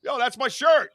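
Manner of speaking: excited yell to a stranger wearing his shirt; loud call out. Retold or imitated. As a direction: call across the field to claim the shirt. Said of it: "I'm not a quiet guy".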